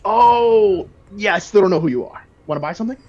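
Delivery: pushy shopkeeper, loud and high; eager merchant. Acted. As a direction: hurry them along to buy. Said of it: "He looks up and says".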